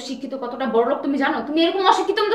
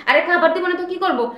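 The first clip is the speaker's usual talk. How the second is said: ordinary talk, nothing marked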